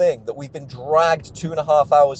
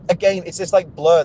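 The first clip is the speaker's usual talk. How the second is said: ordinary talk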